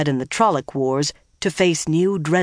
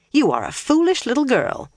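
put-on high-pitched, sharp old nurse; sharp scolding; 9 semitones higher than usual. A narrator's reading. Imagined nurse rebuking her grown charge, sharply. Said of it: "Linny's voice said sharply".

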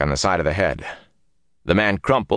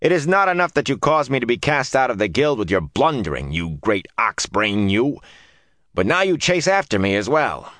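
angry; 5 semitones higher than usual. A narrator's reading. Woman berating her pursuer.

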